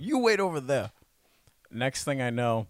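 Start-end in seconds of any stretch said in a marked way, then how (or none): 0.0-0.9 bouncer in a shaky attempted African accent; a clumsy try at an African accent, pitched high. Impersonating the bouncer at the Hive in a clumsy African accent. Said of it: "What type of accent is that?".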